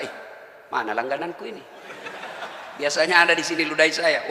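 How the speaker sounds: soft chuckling puzzlement; quiet amused wonder. Acted. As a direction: wonder aloud, gently amused. Prophet misses the spitter, quietly.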